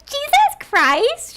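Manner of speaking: high, slow, shrill mocking imitation; mock shocked cry. Mocking a fellow panelist's shocked outburst, high and drawn out. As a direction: exaggerate her horrified gasp. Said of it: "And she gets".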